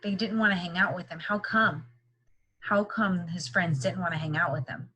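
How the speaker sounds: usual speaking voice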